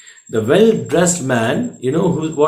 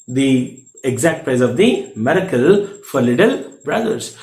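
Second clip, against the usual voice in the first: ordinary delivery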